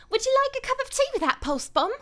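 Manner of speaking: put-on loud, high, quick English accent; high English character voice. Voice actor performing a requested line for chat, high pushed English voice. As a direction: pipe it up high and fast, very English.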